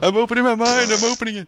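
hammed-up trance; mock trance. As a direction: play the entranced hero. Playing along with quote.

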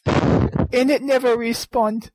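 put-on hillbilly accent. Mock eulogy in accent.

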